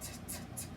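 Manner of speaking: "T, t, t" as short unvoiced whispered t puffs; whispered letter sound "T, t, t"; t sound demo. Shown as a demonstration. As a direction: breathe out "T, t, t" crisply with no voice. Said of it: "tiger with this letter T?".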